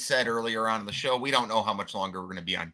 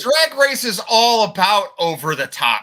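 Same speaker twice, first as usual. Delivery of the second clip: much louder and higher, pushing the point; loud insistence; 10 semitones higher, 10 dB louder than usual. Real, not acted. Co-host shouting down his partner about Drag Race, loud and high. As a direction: talk right over him to win the argument. Arguing back over drag queens.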